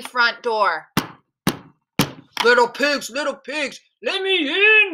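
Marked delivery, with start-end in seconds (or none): 2.4-4.9 big bad wolf calling, leaping up on the demand; growling wolf. A narrator's reading. Wolf demands entry from the three pigs, growling. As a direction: growl it like a hungry storybook wolf. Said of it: "he growled".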